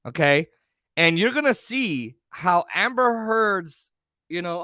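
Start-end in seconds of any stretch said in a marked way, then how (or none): none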